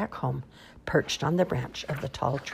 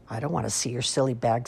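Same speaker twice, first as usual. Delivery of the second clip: usual speaking voice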